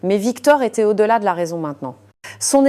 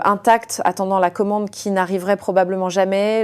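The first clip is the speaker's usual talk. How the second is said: ordinary talk, nothing marked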